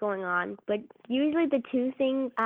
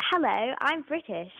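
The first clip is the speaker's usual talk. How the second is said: quick put-on British accent, a touch higher; 4 semitones higher than usual. Asked for an accent, the actress shows off British. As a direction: play it posh English for a laugh. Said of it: "Do you do accents?".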